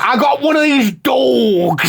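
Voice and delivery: high, drawn-out parody of the bad Manchester-Cockney accent; mock accent impression. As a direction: stretch out a hammed-up mock British regional accent. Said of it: "They're like, they're like".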